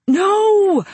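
high, loud cry of No; desperate scream. A narrator's reading. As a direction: scream it out to stop him. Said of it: "I screamed".

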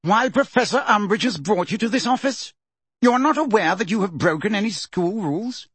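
high-pitched sarcastic questioning; pompous, sarcastic interrogation; heavy sarcasm. A narrator's reading. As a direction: press each question with mock disbelief. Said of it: "a voice positively sagging with sarcasm".

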